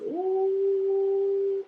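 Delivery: very high, long "ooh"; retold scandalized, high-pitched ooh; drawn-out ooh. Reacting to expired license claim.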